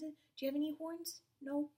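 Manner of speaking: plain reading voice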